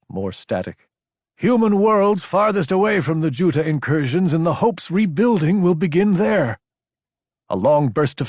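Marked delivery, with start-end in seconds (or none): 1.4-6.6 higher, forceful message voice; transmission voice. A narrator's reading. Sender directing the signal to far human worlds, forceful and raised. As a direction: push the message out through static. Recorded transmission read in character.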